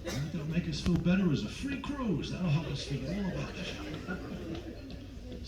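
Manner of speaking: grumbling audience member. Acted. Acting out departing audience members grumbling.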